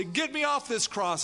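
high pitched retold yell of a prisoner; mock yell. Preacher imitating crucified prisoners yelling, mild mock yell. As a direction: throw it up high like a desperate shout. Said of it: "would yell out and say".

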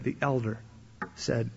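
ordinary delivery

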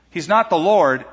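normal talking voice

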